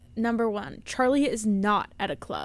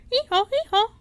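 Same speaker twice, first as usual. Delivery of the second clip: high mock donkey bray; hee-haw noise; 12 semitones higher, 5 dB louder than usual. Friend mocking cramped knees with a high donkey bray. Imitating cramped legs on tiny car.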